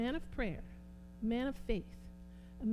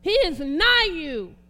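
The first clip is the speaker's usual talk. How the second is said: slow, loud, high declaration from the pulpit; booming preacher's cry; loud proclamation; 6 semitones higher, 15 dB louder, slower than usual. Real, not acted. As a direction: proclaim it to the whole room.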